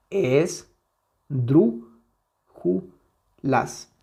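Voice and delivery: very slow, each syllable of Esdrújulas apart. Shown as a demonstration. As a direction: break Esdrújulas into es, dru, ju, las with long pauses. Splits word into syllables. (sílaba por sílaba, muy lento)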